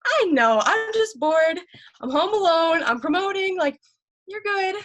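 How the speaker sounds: high, loud, gushing voice message; bubbly excited. Retold or imitated. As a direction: gush like you can't stop talking.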